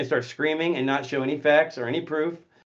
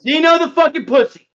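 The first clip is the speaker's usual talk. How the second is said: mocking taunt; 11 semitones higher, 9 dB louder than usual.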